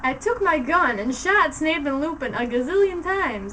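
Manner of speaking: plain reading voice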